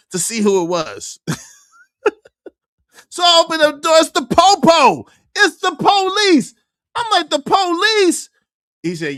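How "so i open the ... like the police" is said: high, loud disbelief; stunned to find police at door; shocked. Retold or imitated. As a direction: tell it in high-pitched shock.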